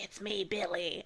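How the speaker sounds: creepy soft killer voice. Retold or imitated. Voicing the killer on the phone.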